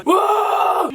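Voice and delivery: loud, high mock wail; imitated shout. Friends at cards hear Chips in her room, imitated high shout. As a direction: imitate a yell from the next room. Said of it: "Chips was shouting her room".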